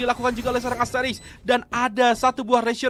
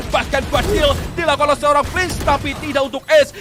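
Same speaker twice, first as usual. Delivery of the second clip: excited play-by-play; 4 semitones higher, 5 dB louder than usual. Real, not acted. Caster calls near ace.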